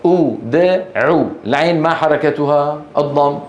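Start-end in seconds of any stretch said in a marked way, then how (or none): 0.1-0.8 أدع begun with a clear u, "ud-u"; sounded-out pronunciation. Shown as a demonstration. Teacher breaking down the verb to find its vowel, sounded out. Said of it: "فنبدأ بالهمزة نقول".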